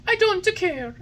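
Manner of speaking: put-on high, loud whiny boy voice; stubborn puppet. A narrator's reading. Puppet refusing the fairy's medicine. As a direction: refuse like a spoilt child.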